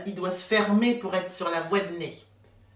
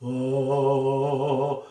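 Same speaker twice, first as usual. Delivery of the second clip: long, very low vibrating "oh"; lowest sound; 8 semitones lower than usual. Shown as a demonstration. Coach has hand on chest, deep held "oh". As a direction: drop to your deepest steady note. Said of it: "le son le plus grave que vous pouvez".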